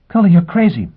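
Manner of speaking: high, loud disbelieving woman's voice; incredulous. Acted. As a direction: exclaim she thinks he's mad. Gisbella reacting to the escape.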